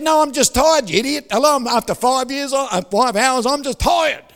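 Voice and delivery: retold exasperated outburst; exasperated yelling. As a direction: snap back, irritated and shouting. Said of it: "He said".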